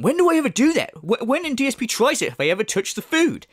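high-pitched mock-indignant protest; mimicking the streamer. Parodist replaying the streamer's objection about touching noodles, mock indignant. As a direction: imitate his indignant objection, starting high and emphatic. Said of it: "and he went".

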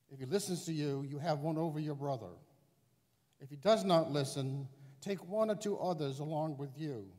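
loud reading in a big church; cathedral echo. Real, not acted. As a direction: proclaim it into a reverberant nave. Gospel proclaimed in large church.

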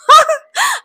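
high burst of laughter; amused laugh. Real, not acted. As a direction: laugh instead of saying it. Amused, withholding the insult.

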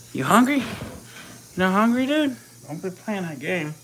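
high, teasing coo at the snake. Real, not acted. Teasing the sidewinder in a high coo. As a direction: coax the snake like a pet.

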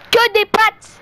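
high loud outburst; excited shout. Real, not acted. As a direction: shout it out, amazed. Repeat, high loud exclaim.

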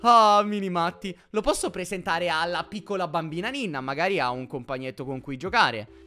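usual speaking voice

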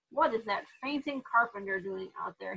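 usual speaking voice

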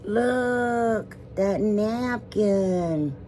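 long drawn-out words of admiration; awed gushing. Pretend. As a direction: marvel slowly, stretching every word. Gushing over a pretty napkin.

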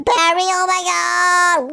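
high, slow drawn-out alarm; startled cry. Pretend. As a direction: stretch it out in shock as he appears. Startled by Spider-Man in game.

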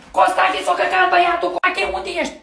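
high, loud yell; calling out urgently. Acted. Wife calling husband to change the baby, urgent yell. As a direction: yell it high across the house.

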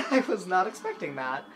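laughing through the words; giggling in real surprise; breathless happy laugh. Real, not acted. Laughing after surprise win.

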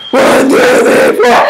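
low, slow screamed count; screamo count-in. Shown as a demonstration. Kid counting in her screamo act, yelling low. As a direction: scream the count like a band intro. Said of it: "This is my Screamo!".

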